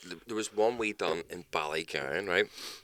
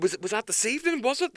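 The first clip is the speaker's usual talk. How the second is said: much higher voice; mock officer. Fake cop questions the takeaway worker, much higher. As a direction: keep the cop voice high. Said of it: "It's like".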